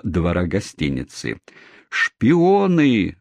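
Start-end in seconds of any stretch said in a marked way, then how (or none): 1.9-3.2 crowd roaring. Acted. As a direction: roar it back as a mob. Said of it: "снова заревела толпа".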